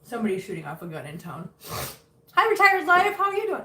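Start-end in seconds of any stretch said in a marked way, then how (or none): 2.3-3.6 excited greeting. Real, not acted. Greeting a viewer.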